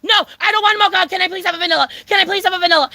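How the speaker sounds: frantic high-pitched blurted plea; acted-out desperate outburst. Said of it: "like, desesperada, way".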